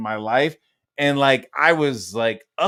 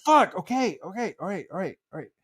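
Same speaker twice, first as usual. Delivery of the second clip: imitating surrender; 5 semitones higher, faster than usual. Son yielding to furious dad, mock meek fading surrender. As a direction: repeat it, shrinking away each time.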